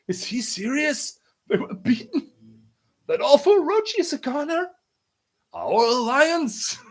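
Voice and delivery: very high-pitched incredulous questions; astonished crowd. Acted. Townspeople hearing their oppressors were beaten, high astonished voices. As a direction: squeak each question in disbelief.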